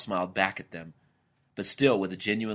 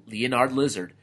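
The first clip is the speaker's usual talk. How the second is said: plain reading voice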